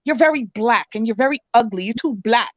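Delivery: ordinary talk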